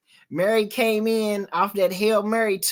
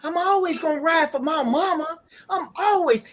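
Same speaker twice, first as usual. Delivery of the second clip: retold loyal vow; 6 semitones higher than usual. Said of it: "He was like".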